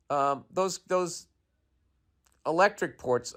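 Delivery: usual speaking voice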